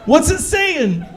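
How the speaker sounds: high, excited "Oh, shit!" then slowed "What's it saying?"; thrilled the omelet talks; excited squeal. Retold or imitated.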